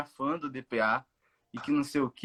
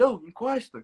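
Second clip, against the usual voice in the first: high-pitched mock police call; re-enacted holler without full volume; retold shout; 8 semitones higher than usual. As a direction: imitate an officer calling out sharply, pitched up. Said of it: "ele gritou assim".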